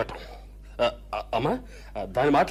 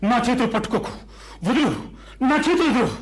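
angry shout; 7 semitones higher than usual. Acted. Husband pulling free of wife's grip: angry higher shout. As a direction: shake her off, furious.

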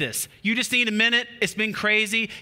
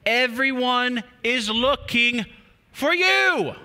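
higher, louder cry that everyone is looking; urgent exclaim; 5 semitones higher, 3 dB louder, slower than usual. Acted. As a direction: call out urgently, voice leaping up. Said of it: "they exclaimed".

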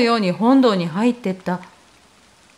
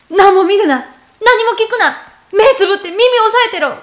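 loud, high commands, faster at the end; urgent shout; 7 semitones higher, 6 dB louder, faster than usual. Retold or imitated. Mother ordering her son to shut his eyes, loud panicked shout.

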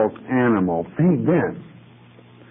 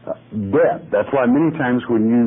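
ordinary talk

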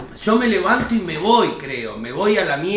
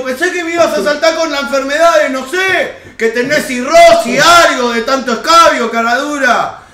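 retold angry rant; 7 semitones higher, 8 dB louder than usual. Retold angry confrontation with friend.

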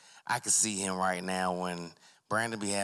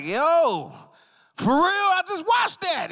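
much higher, louder and slow squeal; mock outrage; 8 dB louder, slower than usual. Guy finding pollen on his car, shrill mock outrage. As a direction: react in disgusted shock. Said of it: "It's like".

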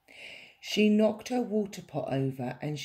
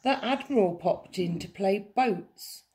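usual speaking voice

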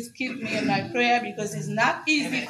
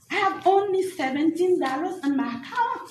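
high-pitched plea to God; retold plea; 7 semitones higher than usual. Fired single mother tells God her plight, pleading.

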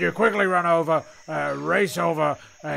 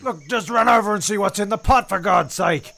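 exasperated; 5 semitones higher, 4 dB louder than usual. Real, not acted. Exasperated repeat to Petra.

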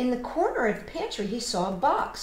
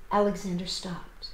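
usual speaking voice

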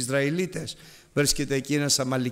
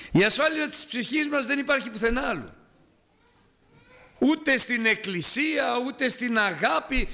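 fervent preaching; 9 semitones higher than usual. Acted. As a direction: lift the congregation with urgent fervour. Preacher rises to fervent exhortation.

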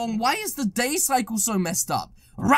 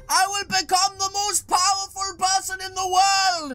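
power boast; 6 semitones higher than usual. Acted. Boasting becoming most powerful.

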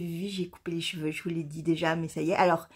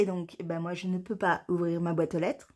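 normal talking voice

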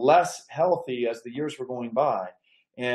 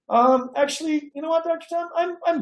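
an old lady breaking bad news gently; elderly Betty voice; 13 semitones higher, 3 dB louder than usual. Betty voiced in retold call.

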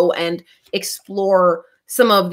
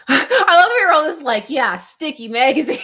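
laughing; 9 semitones higher than usual. Real, not acted. Laughing at chat comments.

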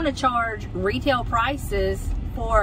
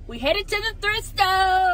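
normal talking voice

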